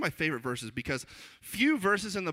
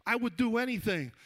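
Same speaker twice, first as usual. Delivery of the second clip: higher-pitched mock bragging; boastful John; 7 semitones higher than usual. Disciple bragging about his shoulders, high incredulous boast. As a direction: protest as an incredulous show-off. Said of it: "you got John over there".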